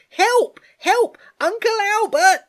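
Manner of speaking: very high and loud scream; screaming for help. Acted. Girl screaming for her uncle in the dark, terrified. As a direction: cry out in terror. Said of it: "she screamed".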